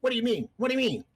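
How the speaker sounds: high squeaky creature voice. Acted. GM voicing monster, high pitched.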